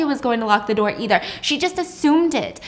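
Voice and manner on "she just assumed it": ordinary delivery, nothing marked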